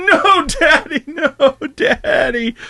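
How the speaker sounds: high, slow whiny plea, laughing through it; dad imitating his son begging him to stop; hammed-up childish no daddy; dramatic protest. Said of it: "He gets real dramatic".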